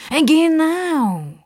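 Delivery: very high, drawn-out exclamation; eager, quick agreement. A narrator's reading. Sidekick jumping in to back up the old man, eager. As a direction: jump in loudly agreeing. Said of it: "sagt der Justus schnell".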